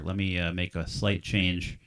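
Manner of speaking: normal talking voice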